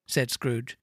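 ordinary delivery, nothing marked

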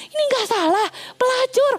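high, stunned gossip voice; scandalised disbelief. Acted. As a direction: spit out pelacur in shock. Everyone gossiping about the prophet.

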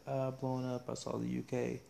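playful fake British voice; mock British accent. Host greeting UK listeners in a mock British accent. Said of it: "offended by that poor accent".

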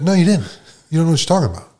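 imitating her telling him off; hint of a bossy counselor snapping back; mock scolding counselor. Counselor scolding him, acted quote.

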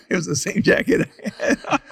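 laughing through the words; chuckling while telling it. Real, not acted. Funny story, laughing.

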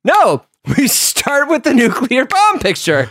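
high, slow, emphatic insistence; drunken bar argument. Acted. Co-host acts out arguing in a bar about aliens, emphatic insistence. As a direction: argue it hard, leaning on the words. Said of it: "we're arguing about how to approach them".

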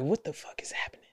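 fast breathless whisper; hissed under the breath in alarm. Retold or imitated. Whispering his own panicked thought. Scared inner reaction.

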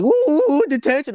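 high, loud taunting singsong; sing-song gloating ooh detention; mocking teacher. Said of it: "she's like".

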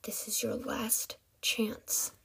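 slow whispered threat; menacing whisper. A narrator's reading. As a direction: whisper the final warning slowly.

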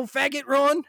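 ordinary talk, nothing marked

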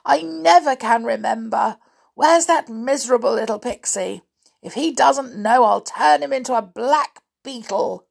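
exasperated storybook giant; grumpy giant. A narrator's reading. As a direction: complain loudly, mildly menacing. Grumpy giant threatens his servant.

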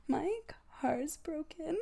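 mock tearful whine; crying, half laughing. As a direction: whimper it between laughs. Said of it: "I'm like".

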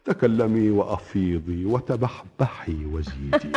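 ordinary delivery

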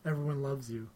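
very soft, low soothing murmur; mock bedtime affirmation. Tape telling Mike everyone loves him, hushed and low. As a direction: lull yourself with hushed praise. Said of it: "the voice says that I tape before I go to bed".

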